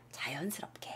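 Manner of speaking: soft whispered reminder. Real, not acted. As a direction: breathe the word out.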